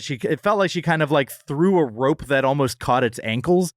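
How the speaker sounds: ordinary talk, nothing marked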